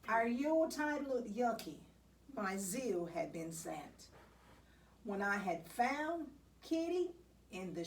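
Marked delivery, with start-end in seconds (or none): none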